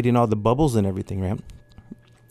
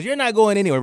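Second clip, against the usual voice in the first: high, scolding repeat; really loud; 10 semitones higher than usual. Retold or imitated. Girlfriend forbidding the trip again, high and scolding. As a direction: lay down the law in public. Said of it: "Really loud in the, in the restaurant".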